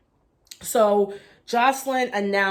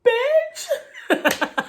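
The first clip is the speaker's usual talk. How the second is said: squealing the word through a laugh; high, laughing imitation of the castmate's jab; laughing mock "bitch"; 4 dB louder than usual. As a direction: crack up while quoting her. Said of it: "she was like".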